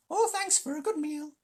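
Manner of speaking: high, sly jackal voice; a squeaky scavenger fawning and sneering. Acted. Tabaki voice held.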